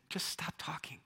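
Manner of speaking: weary whisper; a whispered plea to stop; God bored, hushing the boaster. Pretend. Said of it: "He's like".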